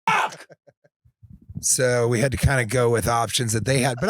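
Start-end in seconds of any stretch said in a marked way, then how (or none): 0.1-0.5 high mock exclamation of dismay; sudden realisation. Realising the banana chips never tasted like bananas, high yelp. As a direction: yelp it as the truth hits.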